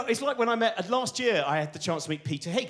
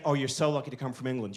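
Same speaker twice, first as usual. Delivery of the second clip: a lightly put-on American accent, lower and gushing; mock American gush; 6 semitones lower, faster than usual. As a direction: gush like an impressed American scientist, a hint of the accent. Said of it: "these American scientists kept saying".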